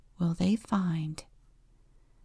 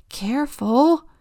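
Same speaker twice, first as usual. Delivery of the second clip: high loud warning cry; yell; 7 semitones higher, 7 dB louder than usual. Acted. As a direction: shout it as the hammock swings. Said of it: "yells Turtle".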